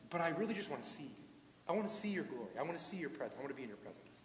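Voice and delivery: very soft, low murmured plea; fading into a quiet, yearning prayer; hushed longing prayer. Acted. Imagined prayer of longing to God.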